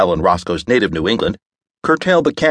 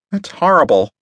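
horrified woman; 10 semitones higher than usual. A narrator's reading. Belle reacts to cannibal tale.

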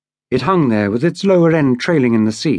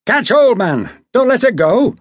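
much higher and louder, calling down; shouting; 9 semitones higher, 3 dB louder than usual. A narrator's reading. Mate shouting down to the seaman on the ladder, loud. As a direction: yell an order to the man below. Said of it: "Mister Brett shouted to him".

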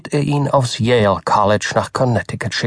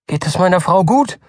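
worried husband; 7 semitones higher than usual. A narrator's reading. Worried husband asks doctor.

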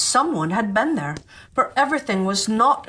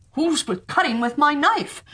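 higher, lightly voiced dwarf; a higher little dwarf, a bit put out; sixth dwarf; 6 semitones higher than usual. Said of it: "And the sixth".

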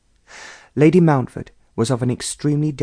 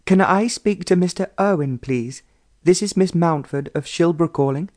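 raised voice on phone; 6 semitones higher than usual. A narrator's reading. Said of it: "the line was a little faint".

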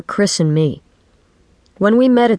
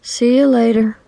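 higher, sighing farewell; listless goodbye; 6 semitones higher than usual. A narrator's reading. Chris flops her hand at her departing friend, listless. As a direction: sigh it out listlessly, pitched up. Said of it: "She flopped her hand listlessly".

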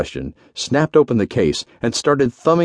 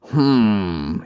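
long, pondering "hmm"; musing hum; 2 semitones lower than usual. A narrator's reading. General ponders over the dead man, musing aloud. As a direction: hum it slowly while thinking. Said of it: "he mused aloud".

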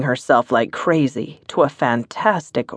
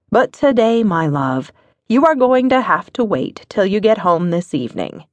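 wife's teasing scold; 7 semitones higher than usual. Acted. Wife teasing husband at breakfast, higher playful scold. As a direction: tease him off with a playful scold.

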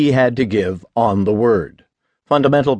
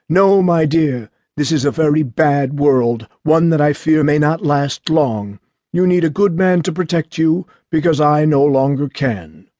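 weak father; 3 semitones higher than usual. A narrator's reading. Sick father explaining why she needs a husband, weak voice. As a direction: plead gently as a frail parent who is failing. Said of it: "His voice was weak".

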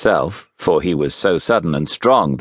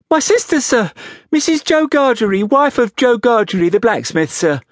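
put-on high child voice easing down at the end; frightened small boy. A narrator's reading. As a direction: recite it nervously, pitched way up. Frightened boy answers convict.

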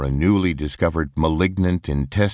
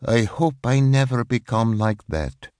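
higher, slightly changed voice, imitating a shudder of dread; mock-shivering at the thought of growing old; retold, mild horror at becoming a crazy old lady; 5 semitones higher than usual. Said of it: "with a shudder".

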